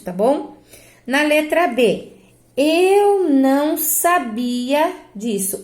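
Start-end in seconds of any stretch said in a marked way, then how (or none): 2.6-4.9 very slow "sabia", stressed sa-BI-a; demonstrated pronunciation. Shown as a demonstration. Teacher showing pupils her pronunciation, slow and drawn out. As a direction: stretch each syllable, leaning on BI in "sabia". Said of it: "Olha a minha pronúncia".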